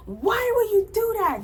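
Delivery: high, loud outraged yell; mock her blowing up at him; imitating an outraged shriek; going off. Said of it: "Just going off".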